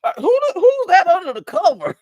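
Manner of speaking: a dad startled to find someone under the blanket; dad surprised. Retold or imitated. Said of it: "And he was like".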